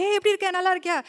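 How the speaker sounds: high, bright-eyed Tamil hello to a returning relative; உற்சாகமான தமிழ் வரவேற்பு; Tamil auntie greeting. Retold or imitated. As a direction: greet her like the aunties back home in India. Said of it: "நம்ம ஆளுங்களாம் வந்து பேசுவாங்களா".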